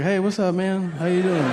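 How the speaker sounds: high-pitched nervous hello; starstruck greeting. Retold or imitated. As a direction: greet a movie star, too shy to look. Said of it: "I couldn't make eye contact".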